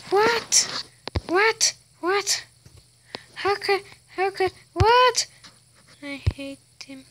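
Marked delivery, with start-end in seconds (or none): 0.1-5.3 high, loud disbelieving what; shocked what. Real, not acted. Kid gamer stunned after losing, shocked and high. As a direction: cry out in disbelief.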